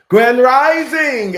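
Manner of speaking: loud, high, drawn-out opening greeting; called-out greeting. Real, not acted. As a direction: belt out the show's greeting, stretching it high. Calls out greeting to open show.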